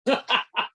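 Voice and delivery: short, high chuckle; amused real laughter. Real, not acted. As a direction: laugh out in quick high bursts. Speaker laughs at the host's remark.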